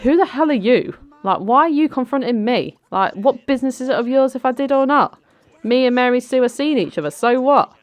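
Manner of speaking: high, loud, affronted comeback; indignant retort. Acted. Brandon confronted over Mary Sue's pregnancy, indignant.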